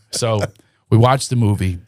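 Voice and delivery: usual speaking voice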